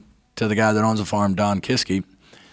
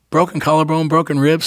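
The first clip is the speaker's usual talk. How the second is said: higher and louder, listing the injuries in disbelief; yelling at his buddy for scaring him; exasperated scolding; 7 semitones higher, 5 dB louder than usual. Retold or imitated. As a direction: scold him like a worried friend.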